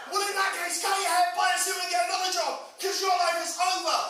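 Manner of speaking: hammed-up dismissive yell; mock scolding shout. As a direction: bellow the life advice at him. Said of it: "They'd be like".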